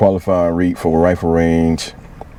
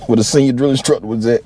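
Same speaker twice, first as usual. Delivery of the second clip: normal talking voice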